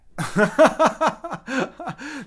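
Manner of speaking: loud, high burst of laughter; high, rapid exasperated cackle. Real, not acted. Camera keeps failing, he bursts out.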